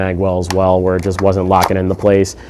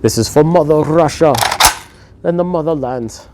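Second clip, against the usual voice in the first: bad hammed-up Russian accent, much higher; mock patriotic Russian accent, pitched way up; 8 semitones higher than usual. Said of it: "Let me try my Russian accent".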